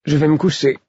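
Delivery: a woman saying goodnight, voiced up high; Astérie's voice. A narrator's reading.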